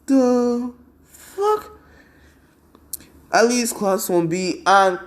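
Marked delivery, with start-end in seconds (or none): 0.0-1.7 stunned. Retold or imitated. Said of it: "everyone's like".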